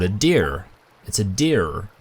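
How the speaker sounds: "deer" with a hard American R; American accent. Shown as a demonstration. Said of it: "in an American accent".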